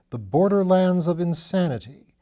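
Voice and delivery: ordinary delivery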